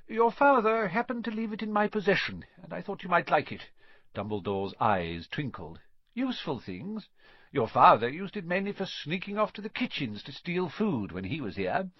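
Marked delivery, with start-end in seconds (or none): 0.1-3.7 put-on higher-pitched, kindly old headmaster's voice; a hint of an elderly headmaster; lightly voiced Dumbledore. A narrator's reading. 6.1-11.9 put-on higher-pitched, kindly old headmaster's voice; a hint of an elderly headmaster; lightly voiced Dumbledore. A narrator's reading.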